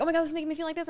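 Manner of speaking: high, rushed panic; mock anxious word vomit; frantic blurting. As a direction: spill it all out in a flurry. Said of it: "You wanna just blurt everything out".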